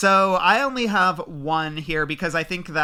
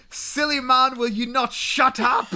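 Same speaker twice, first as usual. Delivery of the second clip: a mock Scottish accent, higher and a bit louder; mock Scottish accent scolding; 8 semitones higher, 3 dB louder than usual. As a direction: play the drunk Scot in a mock Scottish brogue. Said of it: "he had the line where he goes".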